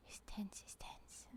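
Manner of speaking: soft whispered tension. Real, not acted. Streamer whispering during a tense Minesweeper move. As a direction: whisper as if the board might hear.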